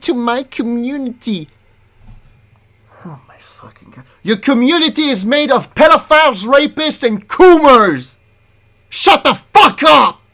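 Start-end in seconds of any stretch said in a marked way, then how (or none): 3.1-4.0 disbelieving whisper. Real, not acted. 4.2-10.2 full-voiced rant at her fans, building to a scream; screaming at the streamer to shut up; furious yell. Real, not acted. Yelling at streamer.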